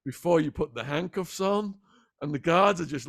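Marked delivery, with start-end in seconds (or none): 0.1-1.7 slow, courteous cowboy twang; polite cowboy twang. Retold or imitated. Beaten cowboy politely asking the guards, slow cowboy twang. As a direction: ask it nice and easy in a cowboy twang. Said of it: "in a polite cowboy twang".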